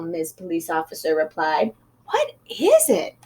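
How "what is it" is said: curious. A narrator's reading. Officer asks.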